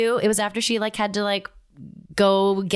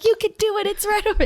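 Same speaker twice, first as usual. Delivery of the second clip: cheering; 12 semitones higher, much faster than usual. Retold or imitated. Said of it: "everyone's like".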